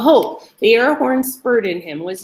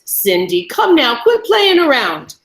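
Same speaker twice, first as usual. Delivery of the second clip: nervous, higher, louder pleading; nervous chuckle; 5 semitones higher, 5 dB louder than usual. A narrator's reading. Chained man urging Cindy to stop, nervous. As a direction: laugh it off but let the nerves show. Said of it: "nervousness caught in his voice".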